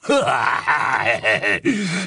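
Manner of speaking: werewolf's coarse laughing roar; burst of laughter. A narrator's reading. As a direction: roar with laughter over the prisoner. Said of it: "Greyback éclata de rire".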